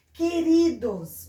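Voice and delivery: "que-RI-dos", stretched and emphatic; strong syllable shown. Shown as a demonstration. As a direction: sound out "Queridos" with weight on RI.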